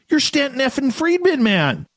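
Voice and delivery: starstruck gush. Retold or imitated. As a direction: blurt it out like a thrilled fan.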